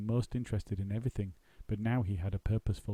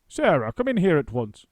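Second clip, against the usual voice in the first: loud urgent call; 6 semitones higher, 10 dB louder than usual. Acted. Actor calling daughter loudly.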